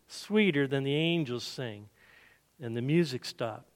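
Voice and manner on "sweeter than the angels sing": ordinary delivery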